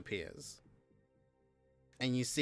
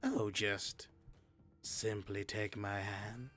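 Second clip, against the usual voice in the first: a quiet, mysterious voice from the darkness; dark whisper behind you; 7 dB quieter than usual. Acted. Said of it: "mysterious voice in the darkness".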